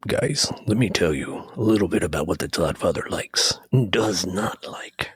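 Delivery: low gravelly-free wrestler announcer voice; wrestling promo. Acted. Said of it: "like, a wrestler voice right there".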